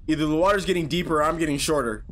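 ordinary talk, nothing marked

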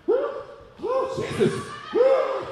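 high, breathless whoos; mock gasping for air, high-pitched; gasping relief. Grown men leaving masked places, hammed-up high gasps. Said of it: "walking out of places like".

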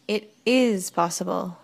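slow, with IS drawn out; "is" stretched for emphasis; stress on IS. A narrator's reading. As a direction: contradict firmly, leaning on "is". Contradicts his "That's impossible.

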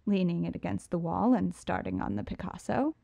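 ordinary delivery

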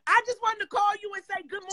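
high, fast, gushing; excited. Real, not acted. Calling a friend to say good morning, excited. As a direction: rush it out, thrilled.

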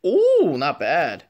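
usual speaking voice

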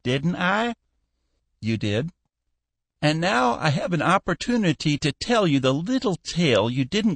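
a hint of a cranky old shipowner grumbling; fussy old boss. A narrator's reading.

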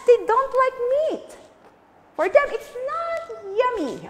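high teasing voice; teacher playfully explaining. Real, not acted. Playful talk to children.

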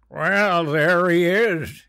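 put-on loud, slow drunk greeting; drunken slur. Drunk father greeting his son, slurred. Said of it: "he slurred".

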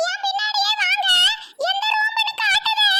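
high and loud, like a whistle; booming nurse voice. Acted. Nurse leading patients to their room, booming high call. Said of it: "எவ்வளோ விசில முழுங்கிட்டியோ? இப்படி பேசுத?".

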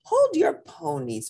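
high-pitched little character voice; Pepito declaring. A narrator's reading. Pepito to the returning acrobat, high declaring voice. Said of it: "Pepito declared".

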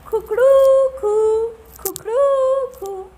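high, loud, slow croaky crow; hoarse rooster crow. Acted. Teacher voices Tikku's croaky crow in story. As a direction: crow with a broken throat. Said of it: "तेरे गले को क्या हो गया?".

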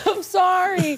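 slow, high, teary laugh-cry; apologizing through tears. Real, not acted. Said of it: "such a crybaby".

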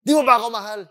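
put-on high, loud hurt-child protest; hammed-up child's complaint. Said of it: "we're like children who are told".

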